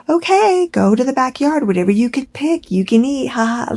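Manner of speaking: high, sing-song mock of a breezy mum; airy mock with a little laugh; mocking Megan. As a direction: toss it off like it's nothing. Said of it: "So I say".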